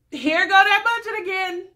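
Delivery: high, loud sarcastic call-out; mock exasperation. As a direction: sing out a fed-up jab, exaggerated. Mock exasperation at cheap dates.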